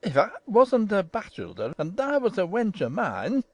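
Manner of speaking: put-on swooping pitch, old gardener ranting; indignant Ben Weatherstaff. A narrator's reading. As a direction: threaten in thick Yorkshire dialect. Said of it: "he cried".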